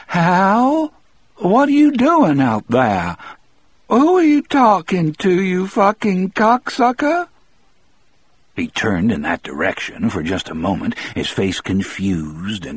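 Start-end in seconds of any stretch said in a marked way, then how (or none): 0.1-7.3 high, wavery sick woman's call; weak, wavery woman. A narrator's reading. Sick wife calls to Hal from inside, weak and wavery. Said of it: "a weak and wavery female voice called".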